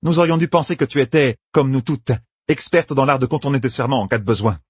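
mock her cleverness; sardonic sister. Yukiri mocking Meidani, lightly sardonic.

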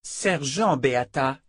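Sergent stressed, slow and firm; firm correction. A narrator's reading. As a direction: insist on the rank. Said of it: "corrigea la jeune Haken".

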